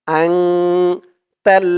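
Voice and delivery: আনতাল slow with a nasal আং; nasal ang. Shown as a demonstration. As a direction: ring the আং at the start of আনতাল. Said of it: "উচ্চারণটা হবে আং".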